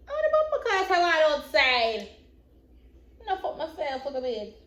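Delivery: high startled oh, then trailing; sudden high yelp of surprise; surprised exclamation. Real, not acted. Exclaiming mid-story.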